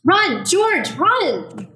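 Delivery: high, loud cry of alarm; panicked warning shout; frantic shout. Acted. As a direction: yell at him to flee.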